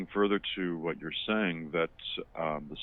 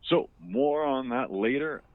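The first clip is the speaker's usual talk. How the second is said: higher, louder anchor sign-off; news anchor voice; 8 semitones higher, 6 dB louder than usual. Acted. Mock anchor toss.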